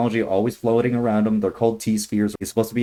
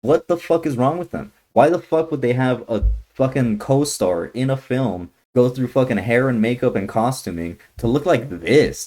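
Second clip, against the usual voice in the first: ordinary delivery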